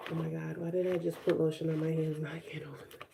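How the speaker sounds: whispered under her breath in annoyance; frustrated whisper. Real, not acted. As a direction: mutter it to yourself, annoyed, in a whisper. Annoyed at lotion on hands.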